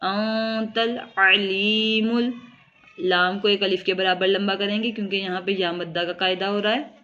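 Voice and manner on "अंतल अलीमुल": slow, drawn-out Quranic chant with held vowels; Quran recitation. Shown as a demonstration. Teacher reciting the verse for students, slow tajweed chant. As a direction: recite it slowly like tilawat. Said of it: "teacher recites verse in tajweed".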